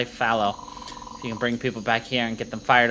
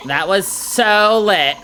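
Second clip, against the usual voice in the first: excited; 7 semitones higher, 10 dB louder, much slower than usual. Acted. Character celebrating the win.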